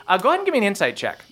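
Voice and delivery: usual speaking voice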